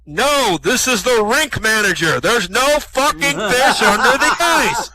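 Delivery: exasperated, high booming shout. Acted. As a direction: bellow it down, building to a drawn-out finish. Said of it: "he hears this booming voice".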